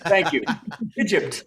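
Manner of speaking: ordinary talk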